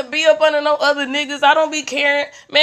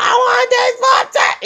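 put-on bratty kid voice; 13 semitones higher, 4 dB louder than usual. Child voiced in store story.

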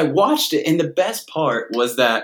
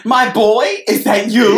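higher, put-on Long Island mom voice; imitated Long Island mother; 6 semitones higher than usual. Mother mistakes caller for her boy, Long Island accent. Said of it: "She's like".

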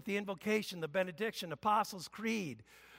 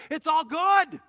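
raised, bright declaration easing off; proclaiming forgiveness; 5 semitones higher, 4 dB louder than usual. Shown as a demonstration. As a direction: announce the good news warmly. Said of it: "I come to you and I say".

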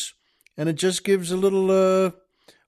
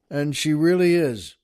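ordinary talk

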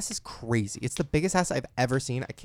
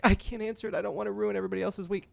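high, pleading imitation of a tearful refusal; put-on distressed whine, no real sobs; mock crying; 6 semitones higher than usual. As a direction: imitate someone upset, pitched up. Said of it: "crying 'cause she's like".

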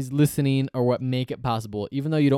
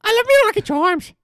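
much higher, loud mock Irish leprechaun; leprechaun Irish accent; 7 dB louder than usual. Host voicing the Lucky Charms leprechaun, mock Irish accent. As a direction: ham up the cereal-box Irish. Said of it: "this is how this man sounds on the cover".

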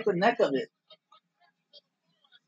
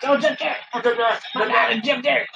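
excited; 6 semitones higher than usual. Real, not acted. Excited recognition of wrestler.

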